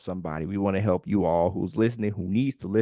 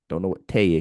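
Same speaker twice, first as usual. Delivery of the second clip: normal talking voice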